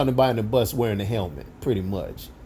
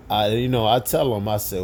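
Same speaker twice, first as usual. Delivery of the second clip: ordinary talk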